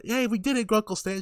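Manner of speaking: bubbly mock triumph; excited child's cheer. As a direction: imitate a thrilled girl cheering to her uncle. Mabel cheers to her great-uncle.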